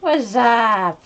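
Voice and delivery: high goofy greeting; silly cartoon greeting voice. Greeting chat in funny voice.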